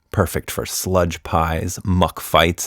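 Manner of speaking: ordinary delivery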